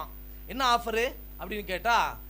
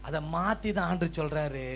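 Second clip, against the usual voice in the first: usual speaking voice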